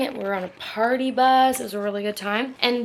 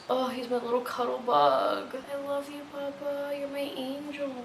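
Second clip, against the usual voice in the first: soft, high cooing to the dog; tender pet talk; 4 semitones higher, 7 dB quieter than usual. Real, not acted. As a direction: murmur sweet nothings to a pet. Cuddling her dog.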